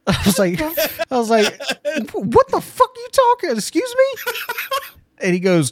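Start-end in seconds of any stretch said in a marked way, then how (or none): 2.1-4.3 very high-pitched, disbelieving squawk; retold incredulity. Insulted diner to the waiter, high incredulous comeback. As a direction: replay the outraged shock, pitched way up.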